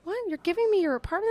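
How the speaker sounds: high, quick, incredulous question; retold disbelief.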